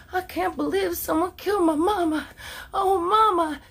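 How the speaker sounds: high, sugary fake-grief voice; a hammed-up innocent daughter crying for mama; mock sweet sad girl. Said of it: "went from these sweet little".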